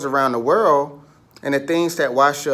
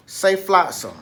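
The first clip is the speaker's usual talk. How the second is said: ordinary talk, nothing marked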